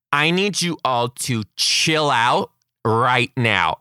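slow, deliberate teacher command; firm slow command. Shown as a demonstration. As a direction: lay down the law calmly. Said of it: "I said it just like that".

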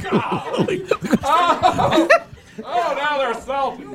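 high squealing laughter through words; laughing hard. Real, not acted. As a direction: laugh helplessly under the massage. Massage pressure, laughing hard.

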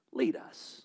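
whispered, barely there. Real, not acted. Pastor urging church to let God lead, whispered. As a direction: breathe the words as a hushed prayer. Gentle prayerful suggestion.

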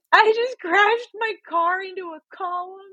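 high, loud hammed-up wail; exaggerated tearful blurting; mock sobbing. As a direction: bawl it out, imitating uncontrollable sobs. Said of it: "uncontrollably sobbing".

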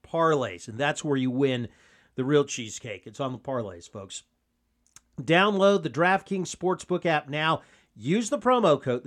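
upbeat sponsor read, pushing the offer. Acted. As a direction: sell it like a radio spot. Reads a DraftKings ad.

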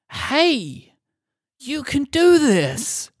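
high, drawn-out mock pep talk; hyped-up promo cheer. Co-host mimicking the product story's pitch, hyped-up promo cheer. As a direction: stretch it out like an over-keen advert.

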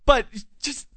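mock outrage. Real, not acted. Ranting to Logan about the homeless woman, mock outraged. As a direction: rail at the entitlement, half joking. Indignant rant about the cookie.

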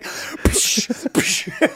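unvoiced mouth-made smack, "psh"; short burst of hissed air; slapping noise. Imitating slaps from the joke, hissed "psh".

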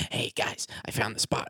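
whispered spider phone call. Pretend. Spider phoning its friends to report the spot, whispered. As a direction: whisper it like a sneaky little spider.

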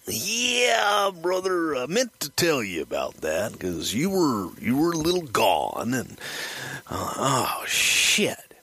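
wrestler drawl starting very high; Randy Savage. Retold or imitated. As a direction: imitate a sheepish confession with swooping pitch. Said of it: "Randy gets this look on his face like".

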